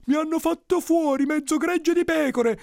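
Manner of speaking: very high, loud complaint; outraged villager. Acted. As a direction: wail the grievance in a high, loud voice. Villager complains of lost sheep. (lamento furioso, acutissimo)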